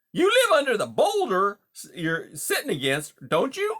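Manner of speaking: high, loud, hearty salesman-like patter; brash, chummy beaver. Acted. Beaver greets a wary mouse as a new pal, brash and chummy. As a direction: play a pushy, overfamiliar beaver, loud and high.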